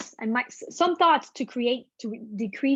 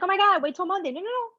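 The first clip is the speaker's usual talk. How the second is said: mock panic; 9 semitones higher, faster than usual. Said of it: "This is not like".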